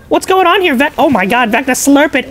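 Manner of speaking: usual speaking voice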